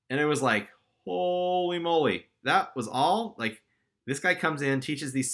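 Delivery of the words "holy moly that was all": higher and slower, drawn-out holy moly; amazed disbelief. Retold or imitated. Rookie marveling at his first sales, stunned and drawn out. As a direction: let the amazement stretch the words out.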